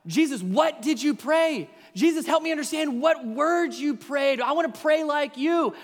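urgent plea to Jesus. Retold or imitated. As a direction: stop everything, we want to know. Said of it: "we're kind of thinking".

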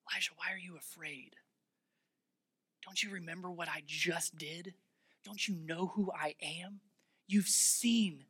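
soft, hushed questioning; breath-soft, tender questions; gentle whisper. Acted. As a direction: whisper it like God gently reassuring someone afraid. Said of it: "the sound of a gentle whisper".

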